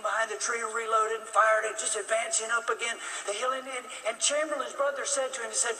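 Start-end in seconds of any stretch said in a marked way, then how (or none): none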